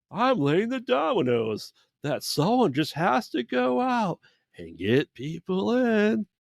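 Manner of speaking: very high, drawn-out mock villain; high-pitched villain voice. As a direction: imitate the villain way up high, stretching the last words. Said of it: "he's a very high-pitched voice".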